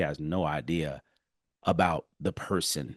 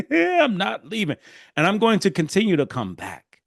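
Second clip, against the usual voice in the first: laughing; 11 semitones higher, 6 dB louder than usual. Real, not acted. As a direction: say it grinning and amused. Laughing restatement of resolve.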